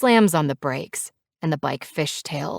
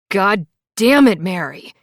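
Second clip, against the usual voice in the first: roaring; 3 semitones higher, 7 dB louder than usual. A narrator's reading. Furious biker cursing at his passenger, roaring. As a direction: roar the curse, straining the voice. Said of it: "He roars so loud".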